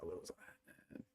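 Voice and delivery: whispered, barely audible repeat; whisper to self. Real, not acted. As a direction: breathe it out to yourself while searching. Muttering to himself, finding his place.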